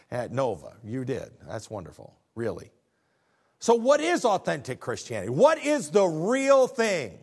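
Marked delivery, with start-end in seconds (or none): 3.6-7.2 loud, high preacher's cry; preaching at full force. Acted. Pastor crying out to the congregation, loud and high. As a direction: lift the question to the rafters. Preacher repeating his question.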